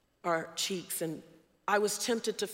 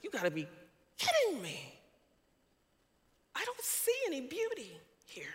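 incredulous thought; 7 semitones higher than usual. Retold or imitated. Inner disbelief retold.

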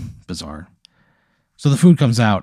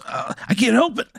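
high, strained little voice; embarrassed man in a stall; 14 semitones higher than usual. Acted. Man in the restroom answering laughter, high helpless plea. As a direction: plead helplessly, high-pitched. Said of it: "and he goes".

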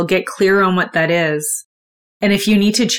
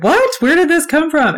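imitating her shocked friend, pitched higher; a retold gasp of disbelief, higher; 8 semitones higher than usual. Friend Destiny hearing about the breakup, shocked and higher. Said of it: "And she's shocked".

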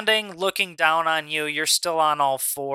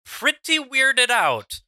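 wry understatement; 9 semitones higher, slower than usual. Real, not acted. Game master stresses wry understatement.

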